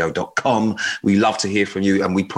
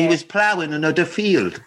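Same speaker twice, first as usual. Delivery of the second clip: a hint of a country farmer, pitched higher; teasing co-host as a jokey farmer; mock farmer; 6 semitones higher than usual. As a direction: lightly play a yokel farmer. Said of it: "I'm not even a farmer anymore".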